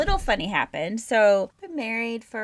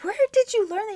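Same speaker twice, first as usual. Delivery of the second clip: higher, louder amazed question; 12 semitones higher, 5 dB louder, faster than usual. Retold or imitated. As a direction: ask in wide-eyed wonder. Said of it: "And I'm like".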